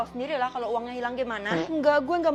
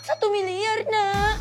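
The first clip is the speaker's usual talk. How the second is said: mock crying; 6 semitones higher, 7 dB louder, much slower than usual. Mother fakes theft to son.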